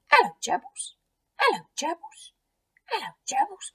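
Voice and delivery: put-on high, squeaky little child voice; two-year-old boy. Eddie greeting gerbils in their cage, toddler voice. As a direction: chirp it like a toddler greeting pets. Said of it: "Eddie loved stroking them".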